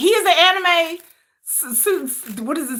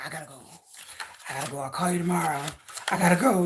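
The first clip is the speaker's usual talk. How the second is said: much lower, softer repeated exit; 10 semitones lower, 8 dB quieter, faster than usual. Retold or imitated. [name] repeatedly telling his girlfriend he has to go, deep and low. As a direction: mumble low, keep leaving. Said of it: "[name] got that deep voice".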